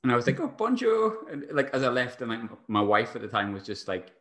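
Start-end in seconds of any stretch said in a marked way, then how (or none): 0.4-1.3 high-pitched French call-out; re-enacted shouted greeting. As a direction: imitate your own breezy parting call. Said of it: "you've just shouted hello".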